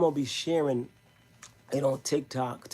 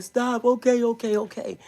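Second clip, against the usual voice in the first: high, loud imitation of pleading; retold panicked plea; 9 semitones higher, 8 dB louder than usual. Friend begging her attacker to stop, mock panicked plea. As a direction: act out a frantic give-in, loud and high.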